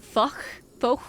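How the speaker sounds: French-accented single word; attempted French accent. Shown as a demonstration. Streamer working out a French word, French accent. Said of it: "with a French accent".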